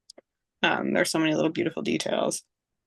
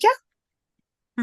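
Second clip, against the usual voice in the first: put-on young daughter =126; 4 dB louder than usual. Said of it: "she was like".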